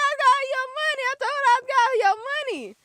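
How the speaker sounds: high, fast, laughing plea; flustered pleading. Retold or imitated. Begging the dealer on the phone, high flustered laughing plea. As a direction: rush it out, half laughing. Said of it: "I was like".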